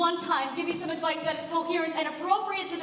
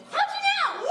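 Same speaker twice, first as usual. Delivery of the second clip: surprised; 3 dB louder than usual. Acted. Baffled question at the news.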